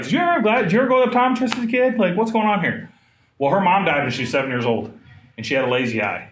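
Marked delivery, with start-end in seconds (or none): 0.0-2.9 puzzled question. Retold or imitated. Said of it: "I was like".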